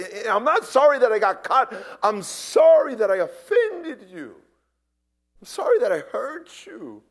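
full-voiced heartfelt sorry; pleading apology. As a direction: plead sincerely to the person you hurt. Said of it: "go to the person you offended and say".

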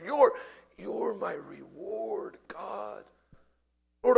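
shifting between whisper and loud pleading; fervent whispered-to-cried prayer; modeled prayer. Acted. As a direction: pray it out as a surrendering sinner. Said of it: "God wants us to, just by his grace, say".

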